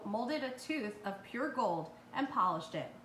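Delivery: usual speaking voice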